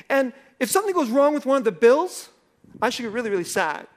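mock sarcasm rising in heat; escalating sarcasm. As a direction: push the jab harder each time. Retort builds, louder.

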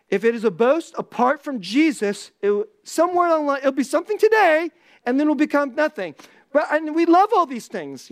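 loud, high, driving sermon voice; fiery preaching. Real, not acted. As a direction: preach it with full force. Preaching at full force.

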